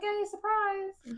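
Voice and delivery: high, slow sing-song surprise; mock surprise. Telling a friend the money came early, high teasing surprise. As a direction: stretch it out high like a playful reveal. Playful surprise repayment quote.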